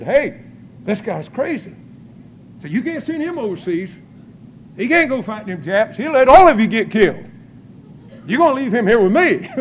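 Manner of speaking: mock-urgent psychiatrist, ending loud and amused; alarmed psychiatrist. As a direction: imitate a doctor warning the brass, rising in force. Retells the psychiatrist arguing to officers.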